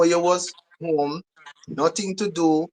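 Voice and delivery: ordinary talk, nothing marked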